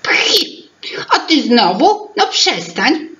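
lightly voiced teapot scoffing at his sister; mild scoff. A narrator's reading. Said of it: "prychnął Imbryk".

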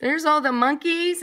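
ordinary delivery

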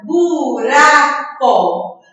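"buraco" broken slowly into bu, ra, co; slow, separated beats on "buraco"; syllable by syllable. Shown as a demonstration. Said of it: "vamos contar quantas sílabas".

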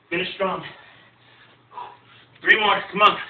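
rally them through the last reps; coach shouting encouragement. Real, not acted. Coach urging final reps.

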